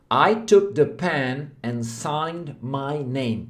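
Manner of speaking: very slow, careful English sentence; slow model sentence. Shown as a demonstration. Teacher models the sentence slowly for students. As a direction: read it word by word. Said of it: "se você fala assim".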